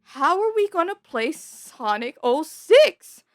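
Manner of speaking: high, loud, laughing halfway, urging yourself to play; excited self-talk. Retold or imitated. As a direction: hype yourself up for Sonic. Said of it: "I would be like".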